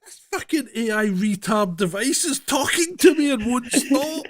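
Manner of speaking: high-pitched mock outrage; retold exasperated rant. Owner explaining talking phone to daughter, mock exasperated rant.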